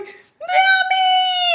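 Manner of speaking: high squealing shout with a laugh; excited shriek. Retold or imitated. Friend sees the positive test and shrieks with delight. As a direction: scream it in delight.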